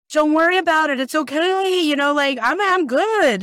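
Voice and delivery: high, bright reassurance; reassuring. Retold or imitated. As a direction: soothe them, lightly. Reassuring crying callers.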